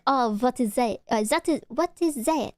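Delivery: put-on botched French accent sliding into Russian; French accent that comes out Russian; bad French accent. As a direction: attempt a French friend's accent and miss. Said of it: "that's not a French accent".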